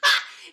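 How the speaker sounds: sudden high, loud laugh. Real, not acted. Reacting to the clip's comeback, loud laughter. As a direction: crack up abruptly.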